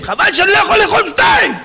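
high, hammed-up outburst; mock-outraged glutton; indignant retort. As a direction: fire it back in a high, shouted voice. Eater retorts about wanting two pots.

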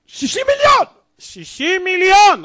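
high, loud cry of disbelief; stunned jubilation. Acted. Lottery winner discovers sixty million, ecstatic high shout. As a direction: shout it high like a sudden jackpot.

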